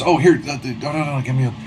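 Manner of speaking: high, flustered vendor offering help; fussing vendor. Acted. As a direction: pitch it up and fuss helpfully. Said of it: "he goes".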